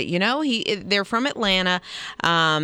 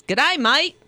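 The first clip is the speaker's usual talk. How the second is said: higher mock Aussie greeting; Australian accent; 7 semitones higher, 4 dB louder than usual. Imitating the patient before surgery, Australian accent. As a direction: put on a playful Australian accent. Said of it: "day of surgery, she's".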